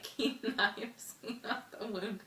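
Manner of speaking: real giggle breaking into a slow recitation; laughing mid-line. Real, not acted. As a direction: try to keep reciting through a laugh. Laughs while misreading the soliloquy line.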